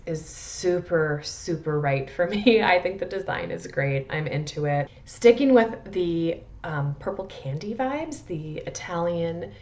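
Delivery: ordinary delivery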